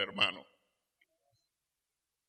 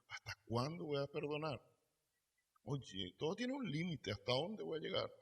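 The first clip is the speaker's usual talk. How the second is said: much softer, musing to oneself; quiet private wondering; inner voice; 4 semitones higher, 9 dB quieter than usual. Pretend. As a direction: let the doubt slip out under the breath. Voicing our inner doubt.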